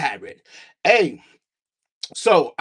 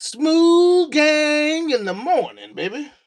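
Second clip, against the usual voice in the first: high, long drawn-out show opener; show intro; 8 semitones higher, much slower than usual. Real, not acted. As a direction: stretch it out like a host's signature call. Opening the show to viewers.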